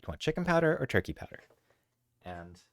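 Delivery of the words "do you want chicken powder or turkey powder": much higher, fast sing-song question; quick high-pitched pet-owner chatter; cat-talk question. Shown as a demonstration. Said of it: "And to just be like".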